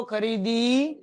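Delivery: each word drawn out slowly for the class to repeat; teacher leading a slow choral recital. Shown as a demonstration. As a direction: chant it slowly with the students. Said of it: "બધાય મારી સાથે બોલો".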